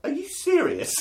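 squeaky high astonished question; dad stunned his daughter wants to come; mock disbelief. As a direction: act floored by it.

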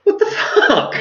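very high, stunned exclamation; shocked disbelief. Retold or imitated. Reaction to man spitting.

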